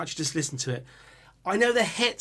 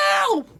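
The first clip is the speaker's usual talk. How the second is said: short, piercing high shriek; 4 dB louder than usual. Retold or imitated. Showing how the singer screams, very high. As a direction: fire off a metal scream. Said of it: "He's like".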